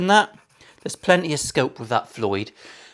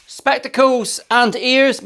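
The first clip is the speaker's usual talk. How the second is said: sing-song safety check; 10 semitones higher, 3 dB louder, slower than usual. Acted. Safety check before cutting.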